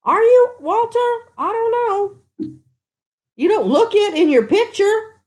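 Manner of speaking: high-pitched surprise; amazed at the news; surprised. Real, not acted. Surprised by viewer's age.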